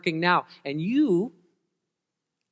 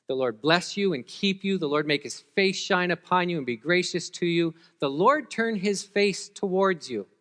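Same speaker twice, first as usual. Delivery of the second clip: blessing; 4 semitones higher, 3 dB louder than usual. Acted. Proclaiming the priestly blessing.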